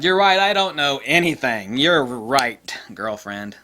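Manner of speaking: loud mock concession; sarcastic retort. Answering the know-it-all customer with loud sarcastic agreement. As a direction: give in with heavy sarcasm.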